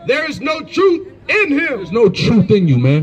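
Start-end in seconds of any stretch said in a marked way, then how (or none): 0.1-1.6 high, ringing verse reading; scripture declamation. Real, not acted. Reader accusing an opponent through scripture, declamatory. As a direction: proclaim the verse in a raised pitch.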